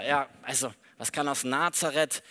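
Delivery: low and quiet, unimpressed; dismissive. Retold or imitated. Nathanael dampening Philippus's joy, low and dismissive. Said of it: "ist so eine Abfuhr".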